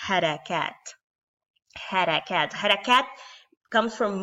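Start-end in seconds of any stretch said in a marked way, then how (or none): 0.1-3.1 "harakat" said the Arabic way, the r tapped; modelling "harakat" for learners; Arabic word harakat. Shown as a demonstration. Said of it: "these symbols are called".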